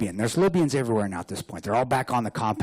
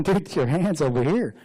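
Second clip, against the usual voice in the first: amused, higher-pitched call; acted call, tickled and raised; smiling; 5 semitones higher than usual. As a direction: call it out through a smile. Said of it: "I'm smiling, going".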